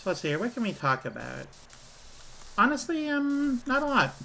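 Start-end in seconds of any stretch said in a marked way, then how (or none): 2.6-4.1 high, squeaky fake-crying whine; mock whimper. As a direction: whine it like a pretend sob. Mock despair over no topics.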